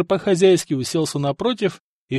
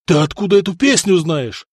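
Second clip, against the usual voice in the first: loud, raised startled demand; agitated shouted question; yelling; 5 semitones higher, 6 dB louder than usual. A narrator's reading. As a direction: demand it at full voice. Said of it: "заорал он".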